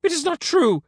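very high, loud scream. A narrator's reading. Caesar denying Render's insult, high scream. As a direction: scream the denial at a high pitch. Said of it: "screamed Caesar".

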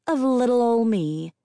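high sweet mock innocence; mock innocent. A narrator's reading. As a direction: say it all sugary and harmless. Narrator mocks own harmlessness.

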